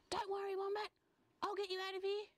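high-pitched kangaroo aside; high, conspiratorial promise of rescue; secretive rescuer. Acted. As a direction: reassure the captive in a high little character voice. Kangaroo secretly reassures wombat.

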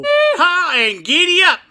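high laughing yeehaw into giddy up. Acted. Cowboy host whooping a laughing greeting.

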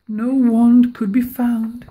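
ordinary delivery, nothing marked

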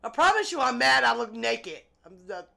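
normal talking voice

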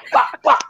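high mock bleating, bah bah; sheep bleat. Guest retelling the audition, mock sheep bleating.

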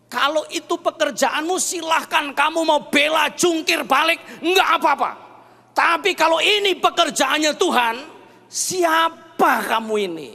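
high, loud, forceful challenge; imitating a wife's stern rebuke; firm wife. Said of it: "kali ini istri saya tegas".

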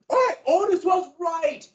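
high, slow shout of agreement; excited colonists cheering. Retold or imitated. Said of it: "these guys, like".

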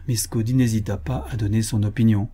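ordinary delivery, nothing marked

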